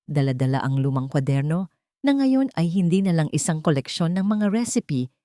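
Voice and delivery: ordinary delivery, nothing marked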